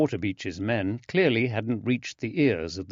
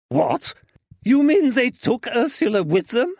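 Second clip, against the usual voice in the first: shocked; 6 dB louder than usual. Acted. Shocked mother hears news.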